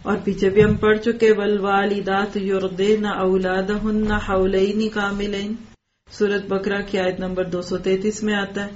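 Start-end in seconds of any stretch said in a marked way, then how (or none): 1.3-5.6 Quranic recitation. Shown as a demonstration. Quotes Quran verse in lesson.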